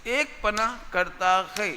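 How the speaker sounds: slow, deliberate repetition for students; drawn-out, careful teaching pace; speaking slowly. Real, not acted. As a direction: spell the phrase out word by word. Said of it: "धीरे धीरे बोल रहा हूँ".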